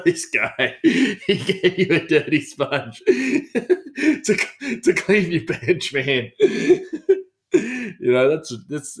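giggling through the words; laughing. Real, not acted. Telling the listeners the sponge story, laughing through it. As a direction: laugh while you tell it.

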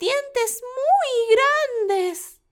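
put-on very high, slow wondering child; curious little girl. Girl asks the wolf.

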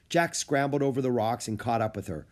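plain reading voice

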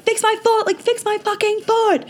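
desperate high-pitched yell to fix her foot; imitating her screams. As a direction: beg them in a retold scream of pain. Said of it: "I kept saying to them".

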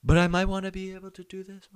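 high, small voice behind a metal door; muffled through a shut door. Retold or imitated. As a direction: keep explaining from behind closed doors. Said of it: "hear them through the shut door".

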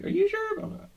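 high put-on squeak of a question; mock catchphrase. Teasing his co-host with a high catchphrase voice.

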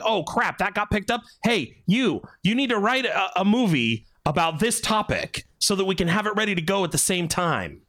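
bossing a writer around in mock panic; a hint of a panicked executive; lightly acted studio exec. Acting out rival studio's reaction.

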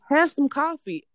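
high, loud mock coworker insisting; hammed-up pushy coworker; insistent coworker. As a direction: press the offer in a mocking coworker voice. Coworker insisting in retold scene.

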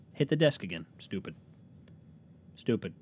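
ordinary talk, nothing marked

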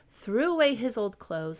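usual speaking voice

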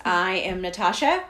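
usual speaking voice

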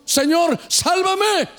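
desperate plea. Shown as a demonstration. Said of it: "mire cómo oye el Señor". (súplica fuerte y aguda)

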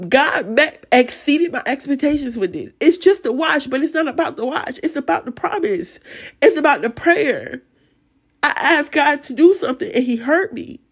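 moved, pitched high throughout; emotional. Real, not acted. Testifying that God answered her prayer, emotional. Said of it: "this is just so emotional".

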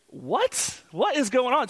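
high-pitched bewildered reaction; retold panic. Teenager reacting to the stranger's order, mock panic. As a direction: act out sudden startled confusion. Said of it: "And so I'm panicking".

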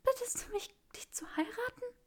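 high, airy disbelieving whisper; breathless whisper. Acted. Granger asks Draco if he proposes, whispering. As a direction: barely breathe the question out.

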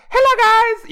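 high, loud mock greeting; earlier chirpy tone. Co-host mimicking the podcaster's earlier greeting, high tone. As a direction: imitate the high-pitched hello. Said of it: "this is your tone prior to that".

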